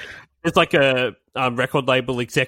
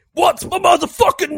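high, loud mock rap shout; 13 semitones higher, 3 dB louder than usual. Host quoting the song's aggressive opening as a loud shout. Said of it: "the very first thing is".

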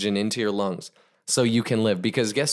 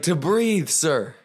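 desperate answer; 5 semitones higher, much slower than usual. Acted. Gasping student finally answers guru.

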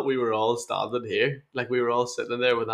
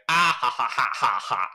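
mock evil laugh; 3 dB louder than usual. Retelling a workmate's prank laugh, mock evil cackle. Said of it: "he'd do a wee evil laugh".

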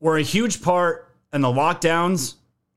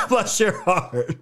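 laughing mock blessing; 4 semitones higher, slower than usual. Mocking sign-off after a laugh.

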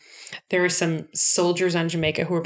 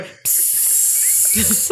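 can opening hiss; 3 semitones higher than usual. Retold or imitated. Beans spilling from the glove.

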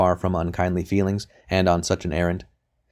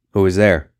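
grumbling voice; 6 dB louder than usual. A narrator's reading. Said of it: "a voice grumbled within".

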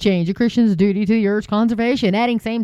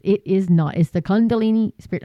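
ordinary talk

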